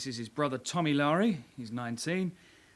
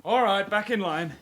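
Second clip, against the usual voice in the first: loud, higher command; barked order; 5 semitones higher, 5 dB louder than usual. Acted. Sergeant orders the suspects back into line, loudly. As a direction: snap them back into line.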